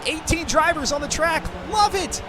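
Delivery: giddy, high cheer over the drivers; excited. Real, not acted. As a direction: delight at the packed lobby. Streamer thrilled by full lobby.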